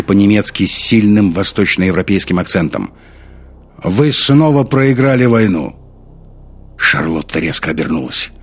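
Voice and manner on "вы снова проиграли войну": slow, high, ringing declaration; loud resonant accusation; loud, sonorous. A narrator's reading. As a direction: proclaim it slowly across the hall. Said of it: "громкий и звучный голос".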